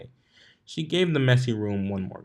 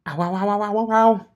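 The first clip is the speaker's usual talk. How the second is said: high, loud yelps of burning pain; 11 semitones higher, 8 dB louder than usual. A narrator's reading. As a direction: yelp louder and higher as it stings. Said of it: "her eyes widened and she dashed to the sink".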